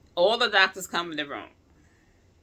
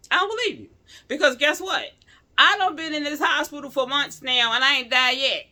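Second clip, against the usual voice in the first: defiant disbelief; 10 semitones higher, 7 dB louder than usual. Retold or imitated. Defiant retort to the doctor.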